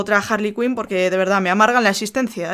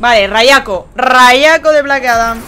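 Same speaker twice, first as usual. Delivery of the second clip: loud; 11 dB louder, much slower than usual. Real, not acted. Doing special move.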